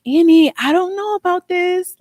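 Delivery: high, loud worried voice of the godmother; nervous godmother. Retold or imitated. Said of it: "and she was like".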